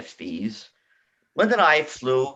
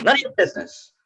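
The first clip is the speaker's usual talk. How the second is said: annoyed snap; 8 semitones higher, 3 dB louder than usual. Retold or imitated. Caller snaps back, high.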